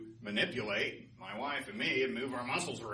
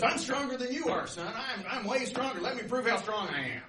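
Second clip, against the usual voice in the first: high, loud, pushy boasting; blustery North Wind; 10 semitones higher, 5 dB louder than usual. Acted. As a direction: challenge them like a blowhard. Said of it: "he's really blustery".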